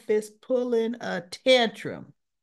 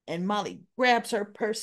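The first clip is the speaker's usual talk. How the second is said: ordinary talk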